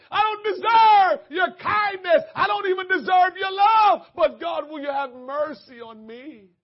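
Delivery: loud, high, sobbing shouted plea; crying for mercy. Shown as a demonstration. Sinner begging God for mercy, loud and sobbing. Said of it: "Here's another one".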